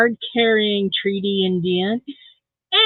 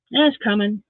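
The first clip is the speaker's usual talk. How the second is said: ordinary delivery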